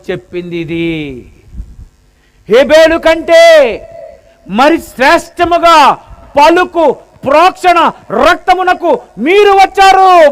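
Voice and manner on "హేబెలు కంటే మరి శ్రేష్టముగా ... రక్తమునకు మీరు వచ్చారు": high, loud scripture proclaimed; ringing preach. Acted. Preacher to church, loud ringing proclamation. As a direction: proclaim it with rising force.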